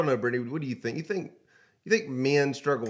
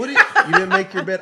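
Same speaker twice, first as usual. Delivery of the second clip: mock surprised; 5 semitones higher, 6 dB louder, much faster than usual. Acting out a mock question.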